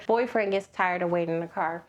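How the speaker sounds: ordinary delivery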